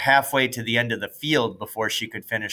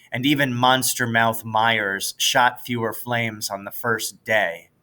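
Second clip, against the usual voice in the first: usual speaking voice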